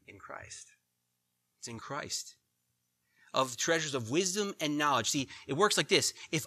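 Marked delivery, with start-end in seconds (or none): 0.1-2.4 whisper. Real, not acted. Hushed emphasis on the answer.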